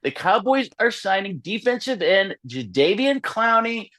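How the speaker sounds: high, quick news-insider read; Adam Schefter impression. Breaking the news as Schefter on air, brisk insider voice. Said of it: "yeah, I'm gonna read it".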